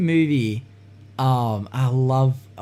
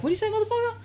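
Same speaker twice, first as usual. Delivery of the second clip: quieter, high-pitched mock challenge; understated attempt at confronting someone; challenge without a voice; 5 dB quieter, much faster than usual. Shown as a demonstration. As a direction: toss off the threat softly. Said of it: "don't even put a voice".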